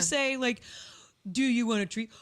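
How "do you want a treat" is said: louder cooing question to a pet; owner talking to pet. Acted. Owner offering her pet a treat, pet-talk voice. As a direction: ask the dog eagerly.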